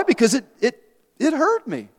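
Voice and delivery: high, halting, hammed-up hurt; mock tearful. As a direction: falter as if about to cry, pitched high. Hams up the old hurt.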